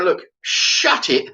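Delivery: high, drawn-out order to stop; exasperated retort. Retold or imitated. Customer cutting off the nagging worker, exasperated. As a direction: cut him off, high and slow. Retold quarrel, cutting him off.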